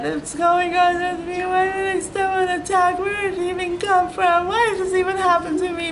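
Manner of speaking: high, half-sung lament; high sung whine of self-pity; sing-song wail. Retold or imitated. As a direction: wail it like a sad tune. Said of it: "I'm like".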